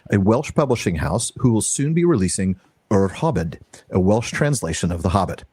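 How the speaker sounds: normal talking voice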